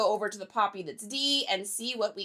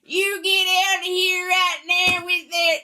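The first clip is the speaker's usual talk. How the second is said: Southern accent impression; 6 semitones higher, 3 dB louder than usual. Shown as a demonstration. Showing viewers the impression, exaggerated Southern accent. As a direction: put on a hammed-up, louder Southern accent. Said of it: "that was my impression".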